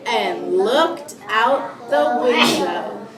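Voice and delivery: slow, deliberate storybook reading; read-aloud to children. Acted. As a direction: read slowly to a class of young children. Teacher reads picture book aloud.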